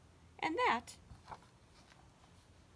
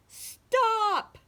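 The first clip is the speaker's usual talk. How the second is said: high, loud shout easing slightly; shouted order; 7 semitones higher, 7 dB louder than usual. A narrator's reading. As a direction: burst out to silence a squabbling crowd. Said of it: "the chief frog shouted out".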